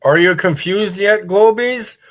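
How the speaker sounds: a gleeful gotcha; mocking taunt. Real, not acted. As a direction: needle the globe crowd. Taunting globe believers.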